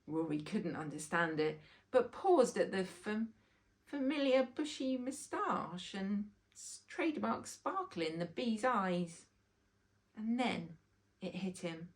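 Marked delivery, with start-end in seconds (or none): none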